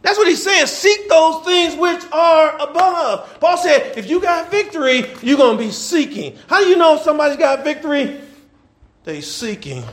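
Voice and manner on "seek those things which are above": shouted scripture. Acted. Preaching the verse at full force.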